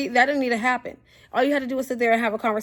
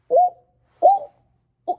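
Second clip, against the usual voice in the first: high, loud shocked oohs; 4 dB louder, slower than usual. Retold or imitated. Narrator watching a girl slapped, squealing ooh in shock. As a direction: squeal it out in disbelief. Reacting to the slap.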